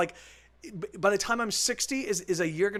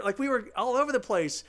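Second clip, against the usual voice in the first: usual speaking voice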